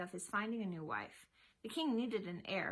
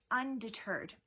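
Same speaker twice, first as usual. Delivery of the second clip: ordinary delivery, nothing marked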